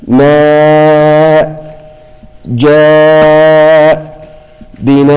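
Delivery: "جاء" held very long and chanted; recited madd muttasil. Shown as a demonstration. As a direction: stretch the alif before the hamza in "جاء". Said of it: "مثل كلمة". (مد متصل طويل في "جاء")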